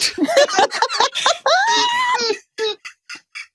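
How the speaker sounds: helpless real laughter; big laugh. Real, not acted. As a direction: break up completely. Reaction to crypt punchline.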